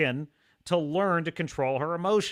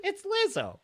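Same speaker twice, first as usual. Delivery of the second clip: mock celebration; 14 semitones higher than usual. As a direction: cheer it out like a fan. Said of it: "Now they celebrate".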